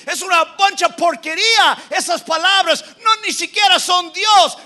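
high, loud mocking shout; scornful Pharaoh. Preacher voicing Pharaoh dismissing God's words, high shouted scorn. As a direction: spit out the contempt.

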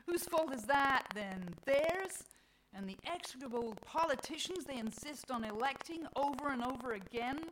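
loud, high, accusing questions; scornful Mother Nature. Acted. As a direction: throw the blame back as Mother Nature. Voices Mother Nature's scornful retort.

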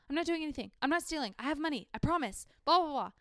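high, rushed, defensive protest; a lightly voiced jittery shoplifter insisting; frantic denial. A caught shoplifter blurts excuses to the manager, frantic.

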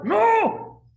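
long, very high, loud "No"; desperate scream. Acted. Man screaming as death takes his hand, desperate yell. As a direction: scream it out in terror, drawn out.

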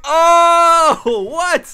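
loud, high shocked cry; shocked yell. Retold or imitated. Shocked reaction in retold scene.

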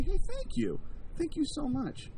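high, soft mock-grateful thanks; grateful reply. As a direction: play sweetly thankful, light and high. Acts receiving a nice letter.

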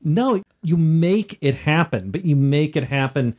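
ordinary talk

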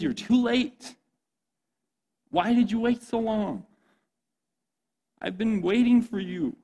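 high-pitched, acted reproach; mad. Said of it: "Jesus shows up and she's mad".

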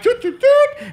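high mock doot sounds; typing noises. Ordering an Uber on his phone, high mock beeps. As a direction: chirp doot doot doot like tapping a phone. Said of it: "I'm like".

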